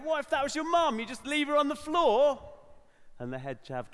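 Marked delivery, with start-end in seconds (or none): 0.0-2.4 high, loud outraged demand; indignant challenge. Retold or imitated. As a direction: shame them with the question. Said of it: "And in the end, I went".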